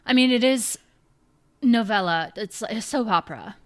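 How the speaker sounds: usual speaking voice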